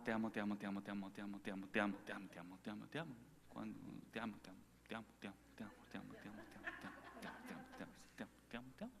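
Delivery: fading love whisper. Retold or imitated. A lover murmurs I love you over and over, fading to a whisper. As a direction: let it sink into a hushed breath.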